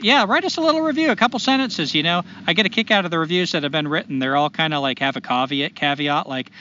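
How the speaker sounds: ordinary talk, nothing marked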